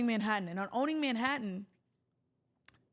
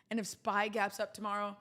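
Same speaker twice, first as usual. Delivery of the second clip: ordinary delivery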